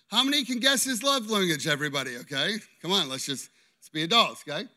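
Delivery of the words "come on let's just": usual speaking voice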